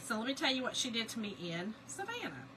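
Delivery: ordinary talk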